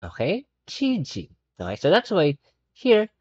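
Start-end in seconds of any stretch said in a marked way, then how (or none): 0.7-1.3 Chi-G split clearly in two; teacher spelling out the sounds for the class; spelled-out chi-g. Shown as a demonstration. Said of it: "ang pronunciation naman yan ay G".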